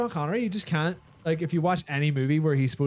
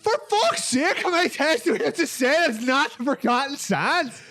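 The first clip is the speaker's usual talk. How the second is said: very high, loud frustrated outburst; real exasperated high yell; exasperated shout; 12 semitones higher, 3 dB louder than usual. Real, not acted. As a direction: yell it in disbelief. Exasperated at repeated wrong guesses.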